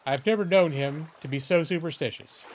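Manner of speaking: normal talking voice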